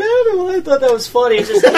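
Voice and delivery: high-pitched, laughing excuse; giggly, helpless explanation; imitating giggly friend. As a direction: mimic someone talking through laughter. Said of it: "just laughing about it".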